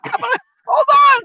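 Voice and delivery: startled protest. Retold or imitated. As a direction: yelp it out in alarm. Reacts to being cut from movie.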